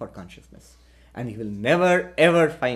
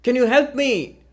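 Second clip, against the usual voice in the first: raised voice, calling down from above; calling down for help; 6 semitones higher, 3 dB louder than usual. Retold or imitated. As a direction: call out to the man on the ground below. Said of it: "he shouts down at the man".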